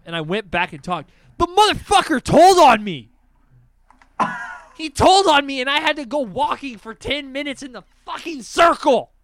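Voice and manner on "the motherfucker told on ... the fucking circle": high, loud, outraged yelling; indignant rant. Real, not acted. Complaining of being tattled on at school, indignant yelling. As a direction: rant at full volume about the injustice. Ranting about being told on.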